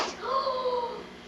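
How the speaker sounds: high breathy gasp of shock. Acted. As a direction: gasp at the awful truth. Sees fat smiling bear.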